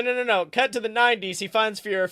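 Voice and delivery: ordinary talk, nothing marked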